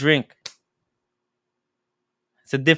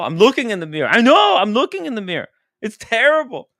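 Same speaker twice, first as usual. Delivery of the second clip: high-pitched defensive whine; exasperated protest; 9 semitones higher, 4 dB louder, faster than usual. Real, not acted. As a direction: protest back with the voice climbing high. Defending himself against her nagging.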